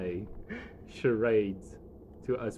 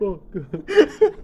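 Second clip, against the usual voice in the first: laughing; 8 semitones higher, 13 dB louder, much slower than usual. Real, not acted. GM breaks up at the doll voicing.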